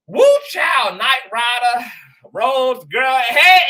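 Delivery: loud, high whooping greetings; excited shout-outs. Real, not acted. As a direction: hype them up, big and loud. Excited shout-outs to stream viewers.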